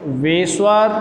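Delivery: word by word, long pauses between phrases; slow classroom dictation. Shown as a demonstration. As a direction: dictate it slowly so students can write it down. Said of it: "teacher dictates definition to students".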